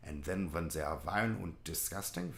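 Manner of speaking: put-on German accent; mock German. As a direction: switch into a caricature German accent. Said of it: "the filthy Germans".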